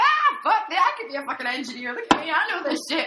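high, loud burst of retold elation; mock triumph. Teacher retells feeling like an engineer, mock triumph. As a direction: crow proudly, hamming it up. Retells own cocky delight at knowing.

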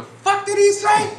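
high, loud mock-indignant yell; imitated outraged outburst. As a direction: play the hothead friend shouting in disbelief. Hothead friend's outburst, acted.

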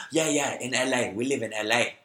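put-on eager Chinese-accented English; broken English with an imitated Chinese accent. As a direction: confirm it in a mock Chinese accent. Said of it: "I'm gonna impersonate their accent".

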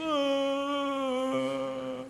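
mock ghostly gasp; startled ghostly gasp. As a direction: gasp like a soul yanked back. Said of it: "E aí, tipo".

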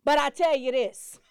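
loud pulpit build; preaching loudly. Acted. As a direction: proclaim it to the mourners. Preacher at funeral.

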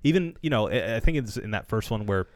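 usual speaking voice

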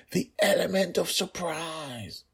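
high, slow pleading warning; stage whisper. A narrator's reading. Father repeating his warning to daughter, slow high whisper. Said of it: "he whispered".

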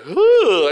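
loud, high retold "ooh!"; mock startled "ooh". Lady reacting to swollen face.

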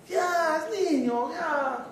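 forgiving mother waving off the school's call; soothing mother. Retold or imitated. Lenient mother voiced.

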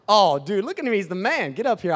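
normal talking voice

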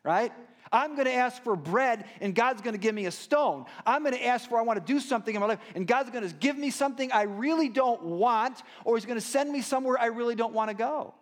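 loud, exasperated voice of someone let down by God; sarcastic complaint about God, voiced loud and high; frustrated doubter. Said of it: "some of us don't ask because we said".

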